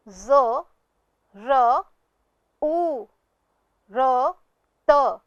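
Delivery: ज र उ र त, one sound at a time; spelled sound by sound. Shown as a demonstration. Teacher to pupils, sounding out जरूरत. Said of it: "अब आखिरी शब्द है".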